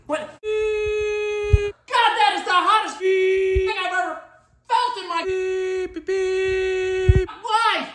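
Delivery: high, strained cry of pain; burned yelp. Retold or imitated. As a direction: yelp it like you just got burned. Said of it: "I come walking up to it and I go".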